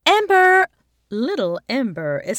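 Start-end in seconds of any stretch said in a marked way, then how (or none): 0.1-0.7 loud, high call across the playground; mother calling her child. A narrator's reading. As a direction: shout for her daughter. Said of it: "turns to call her daughter".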